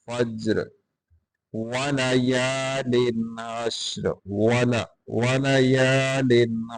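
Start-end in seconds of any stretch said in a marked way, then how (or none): none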